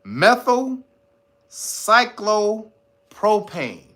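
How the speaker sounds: very slow, broken into chunks; deliberate repetition. Shown as a demonstration. Saying the long word again for listeners, very deliberate. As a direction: spell out methylcyclopropane part by part with pauses. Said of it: "Let me say it again".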